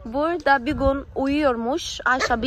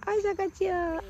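put-on high playful call to a child; teasing the little girl as she runs, in a high singsong; 7 semitones higher, 4 dB quieter, slower than usual. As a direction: tease her sweetly as she runs off. Teasing the child running away.

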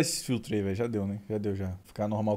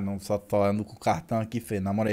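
usual speaking voice

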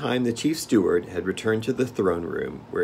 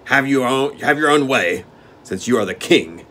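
growled; 5 semitones higher, 7 dB louder than usual. Acted. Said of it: "he growled".

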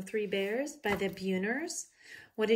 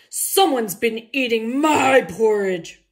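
slow, much louder bear roar; 3 semitones higher, 14 dB louder, slower than usual. A narrator's reading. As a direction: give it the full papa bear roar this time. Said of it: "I'm gonna try those again".

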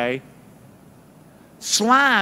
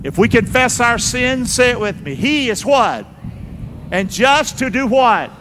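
louder preacher cadence, calling on the room; rally the congregation, pushing the scripture; preaching call-out; 4 semitones higher, 4 dB louder than usual. Acted. Preacher calls and reads scripture.

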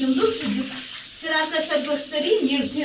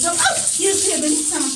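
normal talking voice

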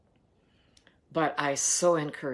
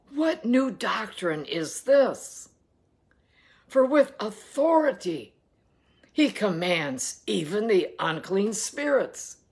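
rising astonishment, climbing high and loud on the last words; amazed crowd; 6 semitones higher, 3 dB louder than usual. Acted. Townspeople amazed at Jesus, building from hushed to a loud high exclaim. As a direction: let their wonder build to a shout. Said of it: "they questioned among themselves".

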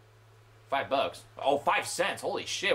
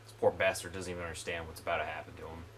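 fast, much lower mock narrator; lightly voiced dramatic announcer; hint of a movie trailer voice; 9 dB quieter, much faster than usual.

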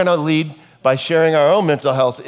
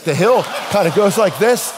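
laughing loudly while imitating the son; 7 semitones higher, 5 dB louder than usual. Son laughing as he mocks dad's fall, loud and laughing. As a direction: burst out laughing at the hill. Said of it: "He's like".

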